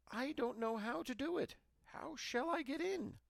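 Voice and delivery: put-on a little girl feigning helplessness to trick the witch; innocent little girl. A narrator's reading. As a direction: play dumb in a tiny child voice. Gretel feigning ignorance.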